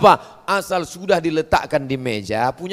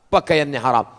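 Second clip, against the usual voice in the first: usual speaking voice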